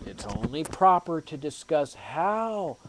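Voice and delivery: ordinary talk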